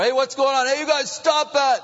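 high-pitched acted crowd shout; yelling in protest. Preacher acting out an onlooker interrupting the roof-breakers, yelling high. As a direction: yell out like an objecting onlooker. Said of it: "Whoever might have been yelling before".

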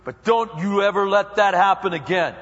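hammed-up begrudging pardon; grudging forgiveness. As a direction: forgive with a warning finger. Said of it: "the kind of attitude that".

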